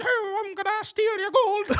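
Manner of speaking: very high, slow cackling squeal; leprechaun voice. Acted. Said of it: "definitely a little higher pitch".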